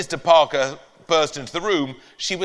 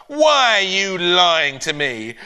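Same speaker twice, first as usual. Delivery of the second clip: slow, louder accusation; accusing neighbour; 5 semitones higher, 5 dB louder, slower than usual. Acted. Neighbour accusing the boy of lying, slow and louder. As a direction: grind out the accusation.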